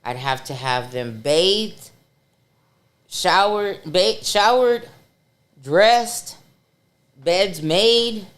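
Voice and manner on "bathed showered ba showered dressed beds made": each chore punched louder and higher; hammering out the list of duties; emphatic listing. Retold or imitated. Telling friends about nurse duties, emphatic loud listing.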